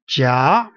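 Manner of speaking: jia gliding upward; rising jia, like a question; second tone. Shown as a demonstration. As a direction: say jia with the pitch climbing to the end. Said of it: "all the way up".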